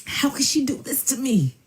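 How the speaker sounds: high, put-on woe-is-me voice; weird mock lament. Man acting weird in the living room, mock lament. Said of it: "he started doing weird stuff".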